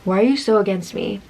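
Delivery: quietly puzzled, no heat; calm. Retold or imitated. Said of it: "very calmly".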